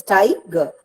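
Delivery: "Tiger" with firm Ti, weak falling ger; stress on first syllable. Shown as a demonstration. Teacher repeating a trochee example, "Tiger" stressed on Ti. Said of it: "ger is not pronounced with much force".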